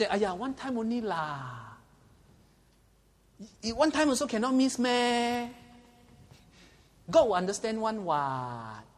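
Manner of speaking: wheedling Singlish friend, one time only. Retold or imitated. Friend's voice pressuring teenager.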